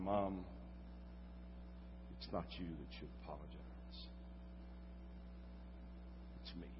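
retold weeping son, hushed; choked up. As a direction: barely get it out through tears. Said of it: "was crying so uncontrollably".

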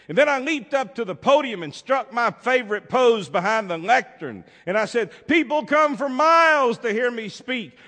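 very loud and high, preening; grandiose preacher. Acted. Baker posing at the lectern for the beggar, loud and high. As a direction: proclaim like a vain orator.

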